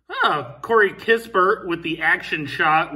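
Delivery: normal talking voice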